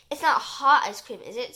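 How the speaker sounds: suspicious check, stressed opening. A narrator's reading. Professor checking the ice cream offer, suspicious. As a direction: ask it warily. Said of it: "says the professor suspiciously".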